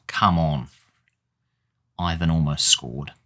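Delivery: normal talking voice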